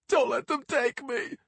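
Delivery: high wailing plea; tearful begging. Acted. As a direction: cry it like a scared kid. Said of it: "burst into tears".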